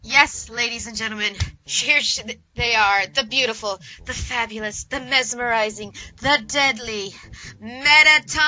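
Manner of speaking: loud, grand stage host introduction; showbiz announcer. Acted. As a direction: hype up the star like a game-show host.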